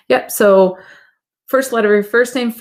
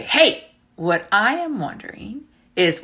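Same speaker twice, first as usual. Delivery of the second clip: usual speaking voice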